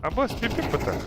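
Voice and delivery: excited callout. Real, not acted.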